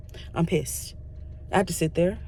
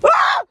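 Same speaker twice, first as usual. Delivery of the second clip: high, loud laughing shriek; real laughing scream, much higher and louder; 8 dB louder than usual. Real, not acted. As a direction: let out a delighted scream.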